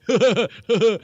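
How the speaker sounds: loud fake mocking laugh; forced derisive burst of laughter. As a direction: laugh at him to belittle. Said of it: "I'm laughing at how emotional".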